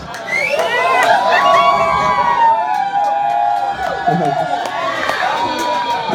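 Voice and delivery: long, high, wheezing laugh at his own joke; comic cracking up at his own bit. Real, not acted. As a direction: break into a long cackle.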